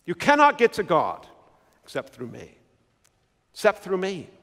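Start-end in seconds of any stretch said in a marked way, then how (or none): 1.8-2.6 very soft, low aside; hushed. Acted. Preacher quoting Jesus to the congregation, hushed. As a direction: drop right down to a hush.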